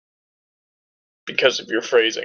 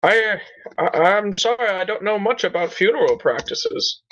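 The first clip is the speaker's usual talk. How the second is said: playing dumb; 7 semitones higher, slower than usual.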